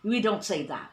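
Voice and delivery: ordinary delivery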